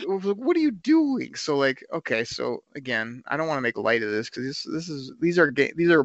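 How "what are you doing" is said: high, slow, stretched-out disbelief; incredulous. Retold or imitated. Fan baffled by defenders leaving Kelce open, slow high incredulous whine. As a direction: draw it out like you can't believe your eyes. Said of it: "I was like".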